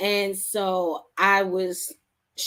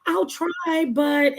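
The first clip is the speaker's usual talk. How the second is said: much higher, louder, hesitant half-promise; reluctant self-doubt; 9 semitones higher, 5 dB louder than usual. Retold or imitated. Answering mentors who urge her to keep writing, doubtful and much higher. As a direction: squeak it out like you don't believe it yet.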